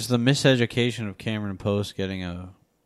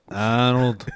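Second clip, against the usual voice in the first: put-on Boston accent. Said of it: "that's a Boston accent".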